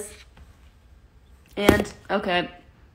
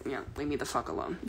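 fast mumbled cat voice, much lower and softer; voicing the grumpy cat; 8 semitones lower, 17 dB quieter, much faster than usual. Owner voicing her tired cat in a low fast mumble. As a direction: toss off the cat's grumpy thought under your breath. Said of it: "he's like".

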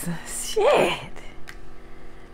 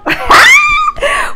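high, loud shriek of laughter; 8 dB louder than usual. Real, not acted. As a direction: burst out in a screaming laugh. Laughing at own joke.

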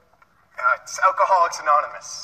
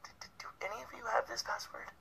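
hushed whisper; 6 semitones higher, 19 dB quieter, faster than usual. Acted. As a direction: whisper the question quickly. Asking group secretly.

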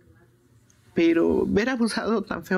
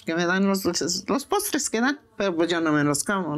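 ordinary talk

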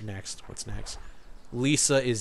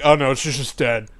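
mock dismissive; 4 semitones lower, 4 dB louder than usual. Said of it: "when they're like".

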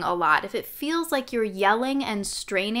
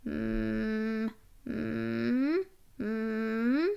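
soft, sustained fry-tinged hum; short held hums with a creak in them; creaky hum; 7 dB quieter, much slower than usual. Shown as a demonstration. Coach demonstrating an exercise, soft creaky hums. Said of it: "this kind of creaky".

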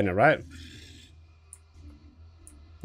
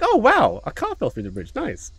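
high, chirpy unfazed delight; mock-happy socially awkward guy; cheerfully oblivious Alan; 9 semitones higher than usual. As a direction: act thrilled by every disaster. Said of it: "he's just so happy that he's like".

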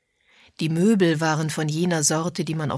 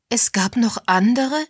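higher-pitched startled question, rising at the end; surprised; 6 semitones higher, 4 dB louder than usual. A narrator's reading. Miss Sims learns other women paid him too, surprised. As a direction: ask it taken aback, climbing sharply.

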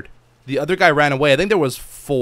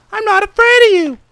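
shrill squeaky taunt, much higher and louder; mock taunt; 11 dB louder than usual. Real, not acted. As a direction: yell a cocky dare at them. Taunting the natives.

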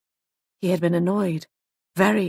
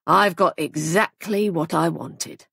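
ordinary delivery, nothing marked

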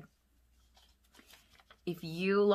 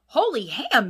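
louder surprised exclamation; cry out; 6 semitones higher, 9 dB louder than usual. A narrator's reading. Tramp finds a sparkling necklace, crying out loudly. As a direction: yelp in surprise. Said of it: "he cried".

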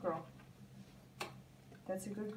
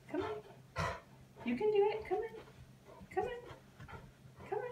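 much higher, sing-song encouragement to the dog; high puppy coaxing; 12 semitones higher, 4 dB quieter than usual. Real, not acted. Trainer coaxing her puppy onto the cushion, high sing-song. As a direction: coax her up like a cheerleader.

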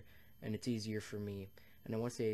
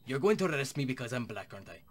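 mock indignant accusation; 2 semitones higher, 8 dB louder than usual.

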